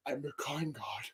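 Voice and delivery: a low, hushed, smug god-king; Xerxes impression. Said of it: "he sounds like my impression of Xerxes".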